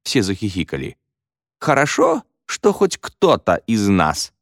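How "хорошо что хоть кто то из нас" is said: slightly pointed comeback; curt retort. A narrator's reading. Boy answering a girl's jibe, curt retort. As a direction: cut the teasing off. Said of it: "отрезал Мо".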